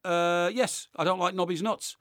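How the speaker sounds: normal talking voice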